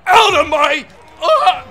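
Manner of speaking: loud, high strained yell; cut-off shout of effort; frustrated shout. Real, not acted. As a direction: yell while fighting someone off. Struggling in the game fight.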